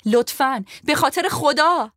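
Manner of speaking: high, loud pleading cry; desperate loud plea. A narrator's reading. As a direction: beg the whole wagon for her life. Final desperate begging. (التماس بلند و مستأصل)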